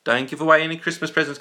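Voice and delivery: ordinary talk, nothing marked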